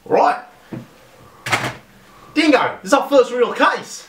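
excited shout. Acted. Ghost hunter telling Dingo about their first case, high excited shout. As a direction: shout the big news to your partner.

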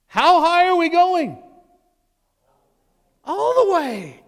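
loud, high preaching at full force; fiery preaching. Acted. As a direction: preach it out with fire.

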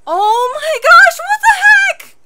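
high, loud outburst rising to a shriek; shocked shout. Real, not acted. Shocked reaction to the show.

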